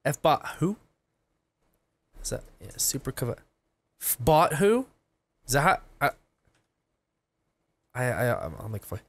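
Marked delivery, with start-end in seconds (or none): none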